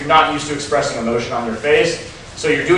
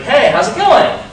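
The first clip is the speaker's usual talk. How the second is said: eager rising greeting, pitched up high; rapport seeking; 11 semitones higher, 6 dB louder than usual. Shown as a demonstration. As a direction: go up at the end like asking a favor. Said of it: "it sounds like a question".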